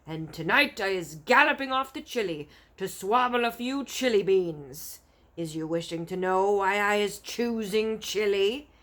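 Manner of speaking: gloating giant. Acted. Giant bragging to BFG.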